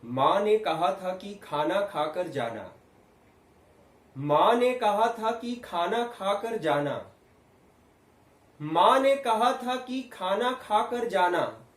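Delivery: slow, loud, climbing to a high full-voiced call; building loud projection. Shown as a demonstration. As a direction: send the voice far, rising in pitch, measured. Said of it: "आवाज मेरी तेज जा रही है".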